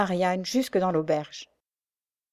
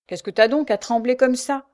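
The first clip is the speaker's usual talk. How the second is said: raised, louder prisoner's question; brusque questioning prisoner; 5 semitones higher, 5 dB louder than usual. A narrator's reading. Thief to her trembling chainmate, brusque question. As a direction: ask it brusquely, pitched up.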